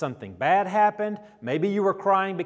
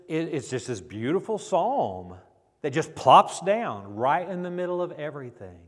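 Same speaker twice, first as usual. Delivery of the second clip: usual speaking voice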